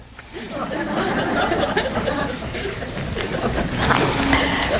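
long unvoiced chuckle; wheezy drawn-out laughter; breathy laugh. Real, not acted. Laughing at football.

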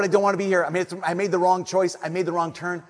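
ordinary talk

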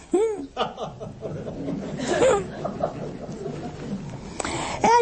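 a high, breathy burst of laughter. Real, not acted. Laughing at his own joke about temper, high burst of laughter.

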